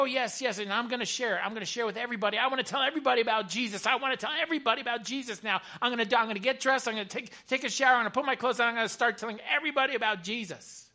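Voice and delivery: high, loud, fast gush of zeal; excited woman, imitated. Chaplain acts out woman's eagerness to share, breathlessly excited. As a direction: mimic her bursting to tell everyone, racing on.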